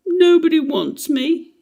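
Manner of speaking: a hint of a child about to cry; voiced high, lightly tearful little boy; near tears. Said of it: "Michael had nearly cried".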